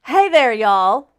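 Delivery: loud, drawn-out hello to the crowd; yelled greeting. Real, not acted. Host greets viewers as the live show starts, yelling. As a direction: belt out the show's opening greeting. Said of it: "You don't have to yell".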